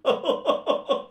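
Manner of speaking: high breathy laughing burst; burst of laughter. A narrator's reading. Roommate laughing at the torn trousers, breathy high laugh. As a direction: roommate cracks up. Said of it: "Manya हसायला लागला".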